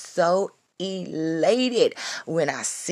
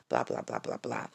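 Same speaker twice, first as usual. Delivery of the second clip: mumbled, much softer and lower; throwaway mumble; dismissive blah; 10 dB quieter than usual. Real, not acted. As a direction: toss it away under the breath. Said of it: "trailing list filler".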